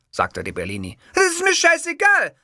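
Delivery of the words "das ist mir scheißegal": high, loud furious snap; foaming rage. Acted. Enraged Witzgür snapping at his producer. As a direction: spit it out in rage. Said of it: "schäumte jener Witzgür".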